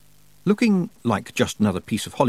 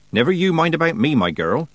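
put-on higher-pitched, bossy old country witch; brisk old witch; 7 semitones higher, 4 dB louder than usual. A narrator's reading. Old witch dismissing a girl's concern, brisk. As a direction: brush the worry aside firmly. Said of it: "said the witch".

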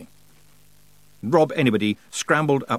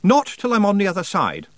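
higher, firm refusal; 9 semitones higher, 4 dB louder than usual. A narrator's reading. Roland refuses to board until the other side, firmly. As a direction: refuse firmly in a raised pitch. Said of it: "Roland said firmly".

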